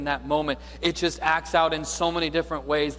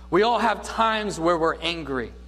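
normal talking voice

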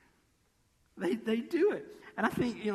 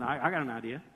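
low-voiced schemer friend; friend with an idea; 5 semitones lower, faster than usual. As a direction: drop low to float a scheme. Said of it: "you know that guy?".

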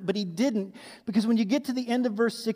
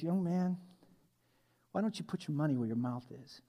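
put-on low, soft old man's retort; lightly voiced old worker, calm and sure; 5 semitones lower, 10 dB quieter than usual. Old man on the site answers the boaster, low and soft.

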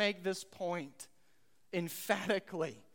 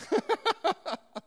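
laugh; 11 semitones higher, 7 dB louder than usual. Real, not acted. Laughs at Sarah's words.

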